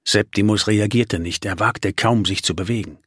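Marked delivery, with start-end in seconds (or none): none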